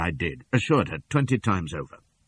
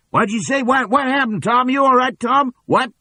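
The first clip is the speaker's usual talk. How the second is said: very high, loud startled lackey; alarmed henchman; 8 dB louder than usual. A narrator's reading. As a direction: call out in confused alarm. George voiced in story.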